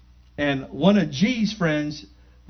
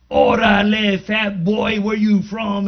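imitating homeboy challenge; 3 semitones higher, 4 dB louder, slower than usual. Gang member challenges him on arrival.

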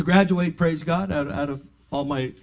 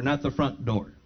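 usual speaking voice

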